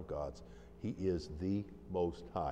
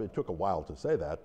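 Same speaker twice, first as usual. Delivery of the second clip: ordinary delivery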